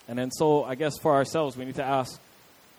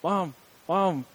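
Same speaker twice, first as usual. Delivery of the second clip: imitating Stewie calling his mom over and over; repeated pestering call for mom; nagging kid; 3 semitones higher, 4 dB louder, much slower than usual. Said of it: "he's just going".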